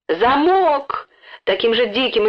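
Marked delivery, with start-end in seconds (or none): 0.1-1.1 high, drawn-out wail; mock-frantic high holler; wild frantic cry. A narrator's reading. Robbed woman answers the boy, frantic cry. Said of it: "истошным голосом прокричала".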